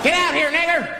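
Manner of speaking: high-pitched mock holler; mob member calling out. Hooded man calling to the caller inside, mock threatening. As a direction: call out as a menacing mob, hammed-up.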